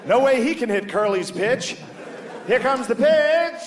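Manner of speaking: high, excited vintage baseball play-by-play; hammed-up old-timey commentator voice. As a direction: call it like an old radio announcer, drawing out the pitch. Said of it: "he knocked that voice out of all the whites".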